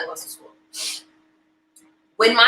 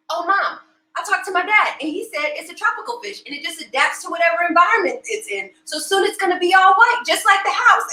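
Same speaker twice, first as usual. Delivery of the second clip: higher-pitched imitation of a little boy; know-it-all kid voice, pitched up; young son; 9 semitones higher than usual. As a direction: explain it confidently like a child. Son explaining to his mother.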